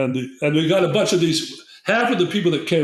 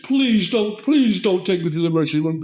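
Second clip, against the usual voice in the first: begging; 4 semitones higher than usual. Retold or imitated. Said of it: "And this kid begged".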